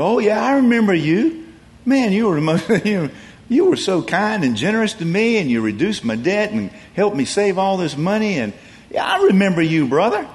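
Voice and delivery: overflowing with thanks, a bit hammed-up; gushing gratitude. Debtor greeting the dismissed steward, eager and grateful.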